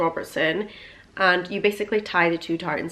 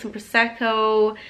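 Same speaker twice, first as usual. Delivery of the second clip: ordinary talk, nothing marked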